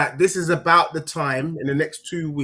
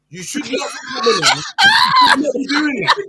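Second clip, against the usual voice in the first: mock outrage; 4 dB louder than usual.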